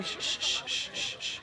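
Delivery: soft unvoiced shh; shushing. Real, not acted. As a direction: hush the room. Quieting a crowd of students.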